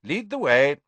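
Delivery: prompt. A narrator's reading. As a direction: answer at once, taking action. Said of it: "he said promptly".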